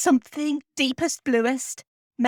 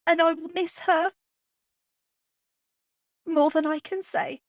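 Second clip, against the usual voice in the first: higher, voice on the edge of tears; real grief for a lost cat; emotional; 6 semitones higher than usual. Real, not acted. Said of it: "this episode is making me emotional".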